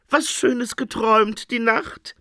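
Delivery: high, oily, friendly voice; cheerful dream interpreter. A narrator's reading. Interpreter greets the Phoenix, oily cheer. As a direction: sound too keen to please.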